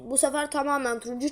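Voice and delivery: normal talking voice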